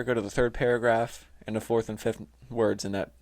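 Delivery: usual speaking voice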